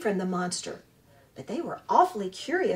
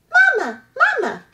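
high, loud little chick cry; peeping gosling; 10 dB louder than usual. Acted. Newborn gosling calling to the robot, high peeping cry. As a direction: peep it out like a hungry baby bird. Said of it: "peeped the gosling".

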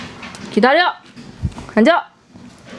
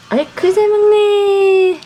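excited cooing; 7 semitones higher, 3 dB louder than usual. Real, not acted. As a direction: coo at the dog. Cooing at the dog eating.

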